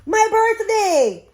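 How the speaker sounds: high, loud and slow birthday shout; a girl waking up thrilled it's her birthday; excited cry. A narrator's reading. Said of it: "she cried".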